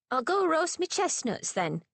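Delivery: put-on cheeky boy. Boy voice in story.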